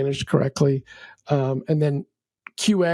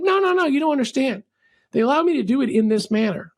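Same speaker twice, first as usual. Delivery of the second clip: insistent customer; 8 semitones higher than usual. Retold or imitated.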